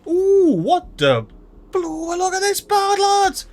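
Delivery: high, delighted shriek at a bird; thrilled high-pitched wonder; excited squeal. Real, not acted.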